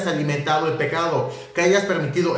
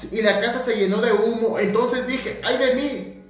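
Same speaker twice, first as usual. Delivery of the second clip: higher, lifted reading of scripture; 4 semitones higher than usual. Acted. Preacher reading the seraphim verse to the church, higher and lifted. Reads Isaiah aloud in preaching.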